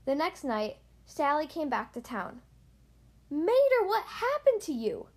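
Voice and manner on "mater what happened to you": high-pitched, alarmed exclamation; shocked gasp. A narrator's reading. Sally seeing damaged Mater back in town, shocked. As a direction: exclaim it high, loudest on the name. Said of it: "she gasped".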